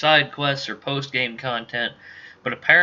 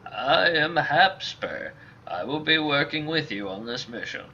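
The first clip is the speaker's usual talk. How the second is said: put-on old mercenary; 4 semitones higher than usual. Old mercenary meeting his new partner, old man voice. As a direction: play a courteous old mercenary.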